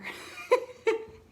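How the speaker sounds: high-pitched unvoiced giggle; high burst of laughter. Real, not acted. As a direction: laugh it out at the story. Ending story with laugh.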